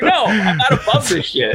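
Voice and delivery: high, giggly mock defiance; laughing retort. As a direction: laugh it off while owning it.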